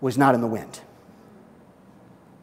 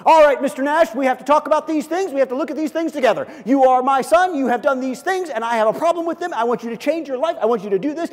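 high, fast, loud stern schoolteacher; a hammed-up stern teacher scolding a pupil; stern teacher God; 8 semitones higher, 8 dB louder, faster than usual. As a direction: lecture the pupil like a strict teacher. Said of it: "God is sitting there ... stern look".

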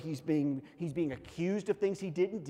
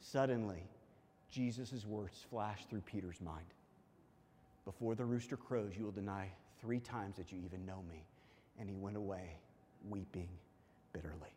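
low, very soft, sombre narration; hushed scripture reading; 8 semitones lower, 10 dB quieter than usual. A narrator's reading. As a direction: let it sink away quietly toward the last word. Hushed scripture reading after the climax.

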